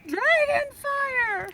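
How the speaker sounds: high, slow drawn-out cry; spooky dragon call. A narrator's reading. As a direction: wail it like a ghostly warning. Brothers fake a dragon alarm.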